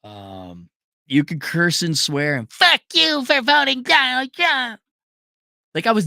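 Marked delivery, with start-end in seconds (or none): 2.5-4.8 high, slow sneering parody of a hysterical voter; mock liberal outburst. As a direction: squeal it like a caricature. Mocking an emotional liberal.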